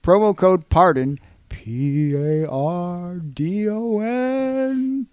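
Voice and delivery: loud, slow, eerie promo code pardon; spooky voice. Shown as a demonstration. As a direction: spell out pardon like a ghost. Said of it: "just trying to do a spooky voice".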